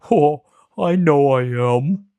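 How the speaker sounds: slow, loud, sorrowful lion voice; a big cat owning his shame; sad lion. A narrator's reading. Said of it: "he said sadly".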